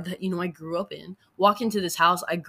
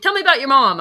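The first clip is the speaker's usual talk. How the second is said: mock therapist, higher and louder; therapist quote; 8 semitones higher, 11 dB louder than usual. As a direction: playfully imitate the therapist. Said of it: "She's like".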